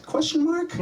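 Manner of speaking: "Question mark?" lifted very high at the end; spoken question mark. Shown as a demonstration. Comic needlessly says the punctuation aloud, questioning. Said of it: "didn't need to say question mark".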